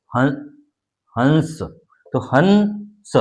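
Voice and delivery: हंस very slow, nasal after ह; slow anusvara demo. Shown as a demonstration. Teacher drawing out हंस for students. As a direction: drag हंस out slowly with nasal following. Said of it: "और ये शब्द है".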